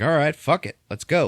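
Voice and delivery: mock pumped-up cheer; rallying cheer. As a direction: fire up the crew. Crew agreeing to fight.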